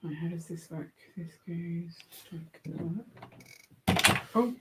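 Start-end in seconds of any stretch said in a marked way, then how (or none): 0.0-3.0 hushed murmur while concentrating; whispering to self. Real, not acted. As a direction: quietly think aloud over the build. Muttering to self while building.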